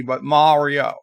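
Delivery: ordinary delivery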